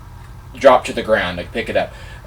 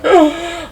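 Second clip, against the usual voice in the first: starstruck gasp; 7 dB louder than usual. Retold or imitated. Nicole Kidman acknowledges him.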